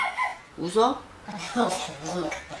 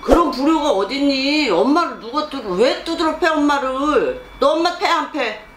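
raised, pressing rebuke demanding an answer; real angry scolding; 5 semitones higher than usual. Real, not acted. Shaman scolds client's son for hitting his mother, angry. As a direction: scold indignantly at a higher pitch.

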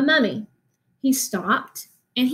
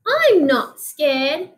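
higher, slow, bold claim; brave little mummy; 5 semitones higher, 4 dB louder, much slower than usual. Acted. Teacher repeats baby mummy's claim in a higher, slower voice. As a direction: puff up and insist you're brave.

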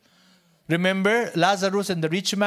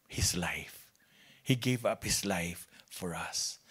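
whisper; 11 semitones lower, 9 dB quieter, slower than usual. Acted. Whispered climax of sentence.

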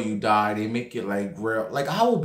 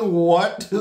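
high, loud disbelieving what; stunned what; 6 semitones higher, 5 dB louder than usual. Retold or imitated. Told the man died minutes ago, a loud high stunned question. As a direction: hit it like a double take. Reacting to news of death.

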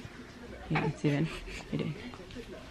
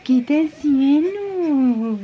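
higher, very slow coo; 7 semitones higher, 3 dB louder, much slower than usual. Real, not acted. Mother cooing at what her baby is doing, higher and very slow. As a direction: stretch it out sweetly.

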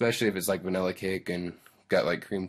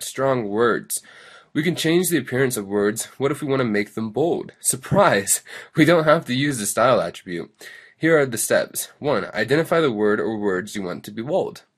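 loud, peppy tutorial read; lesson read-aloud; 3 semitones higher, 6 dB louder than usual. Acted. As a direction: read the instructions out with big lively emphasis. Reads the lesson text aloud.

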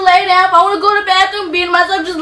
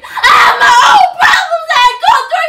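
yelling; 8 semitones higher than usual. Real, not acted. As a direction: let it burst out at him in frustration. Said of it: "Why is you yelling?".

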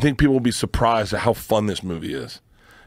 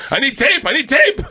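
higher, louder frantic repeat; hammed-up panic, higher and louder; mock desperation; 5 dB louder than usual. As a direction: beg for tape, frantic.

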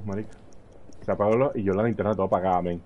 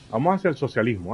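usual speaking voice

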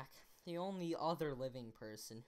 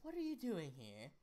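lightly put-on accent, British-style; a hint of a British mother; instinctive British accent. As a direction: slip into a British accent at usual pitch. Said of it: "Why do I just instinctively do an accent?".

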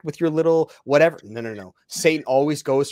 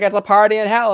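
loud, high mock cheer; raised, mocking party voice; mocking partygoer; 8 semitones higher, 9 dB louder than usual. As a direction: imitate a clueless hell-joker, pitched up. Imitates people joking about hell.

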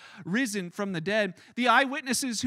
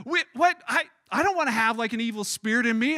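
alarmed disbelief; 6 dB louder than usual. Retold or imitated. Teenager reacts to mom's rebuke, alarmed disbelief. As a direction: play up the bewildered teenage shock.

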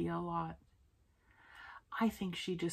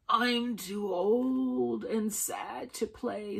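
slow, slightly higher weary character voice; old, sad boy; 3 semitones higher, much slower than usual. A narrator's reading. Grown boy turns down tree's invitation, old and sad. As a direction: drag it out like someone old and sad.

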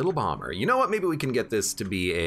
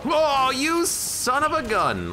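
exasperated; 3 dB louder, slower than usual. Real, not acted. Hit by a boss attack.

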